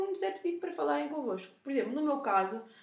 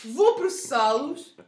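threatening customer; 10 dB louder, much slower than usual. Retold or imitated. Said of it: "o meu top três de ameaças".

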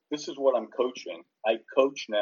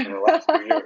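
much higher and louder playful repeat; high squeaky echo; 9 dB louder, faster than usual. Real, not acted. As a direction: squeak the phrase back up high.

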